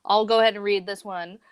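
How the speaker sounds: ordinary talk, nothing marked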